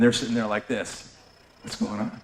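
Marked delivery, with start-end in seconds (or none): 1.6-2.2 whispered puzzled question. Retold or imitated. Neighbors whispering at the slammed door, puzzled. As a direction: breathe it out, confused.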